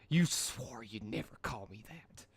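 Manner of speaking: quiet slow shocked reproach; shocked hurt. Acted. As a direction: say it stung and betrayed. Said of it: "Dash gasped in shock".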